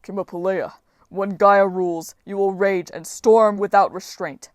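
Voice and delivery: portentous giant's persuasion; grand giant. Acted. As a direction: proclaim it like a mighty giant. Giant grandly woos the goddess.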